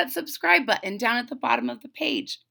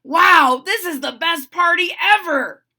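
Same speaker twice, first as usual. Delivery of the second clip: loud, high excitement; thrilled exclamation; 7 semitones higher, 10 dB louder than usual. A narrator's reading. Freddie at the costume ball, thrilled. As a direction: burst out with it, thrilled.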